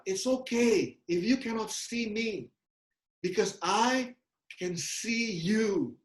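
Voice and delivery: slow, steady reassuring father; calm her from below, slowly and surely. Retold or imitated. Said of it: "Lalu bapaknya teriak dari bawah". (ayah menenangkan anaknya dengan pelan dan mantap)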